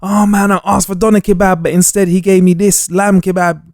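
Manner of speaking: mock petty whinge; petty complainer. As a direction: play someone griping over a trivial upset. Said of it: "Or when somebody goes".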